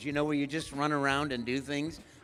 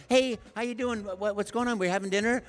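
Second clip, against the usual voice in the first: eager greeting; 6 semitones higher, 5 dB louder than usual. Retold or imitated. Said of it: "and I go".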